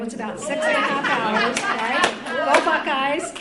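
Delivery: loud, happy shout to the room; cheering shout-out. Real, not acted. Speaker cheering her Ohio team to the audience, loud and excited. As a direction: call out to the home crowd.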